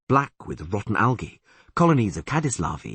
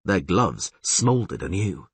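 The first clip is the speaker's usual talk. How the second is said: usual speaking voice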